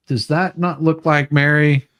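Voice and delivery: pointing it out, thrilled to show her; excited. Real, not acted. Showing Mary the video.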